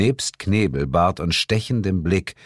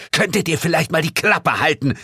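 higher-pitched irritated outburst; exasperated call; 10 semitones higher than usual. A narrator's reading. Narrator calling out to silence his teasing friends, exasperated. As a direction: tell them to shut up, exasperated. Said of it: "rief ich".

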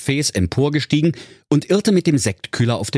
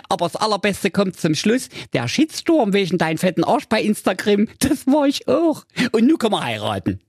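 lightly higher, self-satisfied confession; smug Mirko; 10 semitones higher than usual. Acted. Mirko revealing his disguise to Jacqueline, smug and higher. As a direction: gloat kindly while revealing the trick.

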